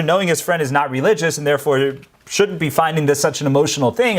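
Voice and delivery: ordinary talk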